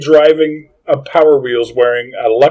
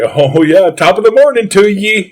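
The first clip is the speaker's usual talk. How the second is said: hammed-up Saint Patrick's Day Irish accent; a jokey stage-Irish lilt, a bit higher; mock Irish accent; 5 semitones higher than usual. St Patrick's Day party-goer greeting friends, hammed-up Irish accent. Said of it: "He does what we do on Saint Patrick's Day".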